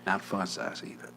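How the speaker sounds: soft, unvoiced sulk; whispered grievance. Real, not acted. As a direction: mutter it like a private grudge. Sulky aside about the gift.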